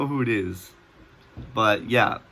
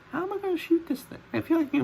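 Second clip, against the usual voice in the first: very high, fast squeaky voice; 4 dB quieter, faster than usual. Retold or imitated. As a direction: squeak it out in a panic. Squeaky panicked self-quote.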